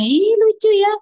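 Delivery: high, loud delighted squeal; child thrilled by the bubbles, squealing with delight. Retold or imitated. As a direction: gush like a thrilled child at the foam. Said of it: "kalau ada gitunya".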